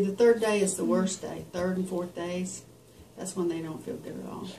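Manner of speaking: ordinary talk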